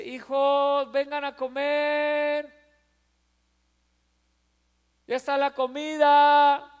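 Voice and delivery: put-on high, slow, loving call to the kids; tender motherly call. Mother tenderly calling her children to eat. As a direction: call the children sweetly to dinner. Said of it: "les dice una voz muy tierna".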